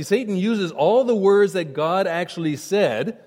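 ordinary talk

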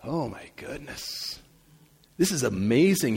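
ordinary talk

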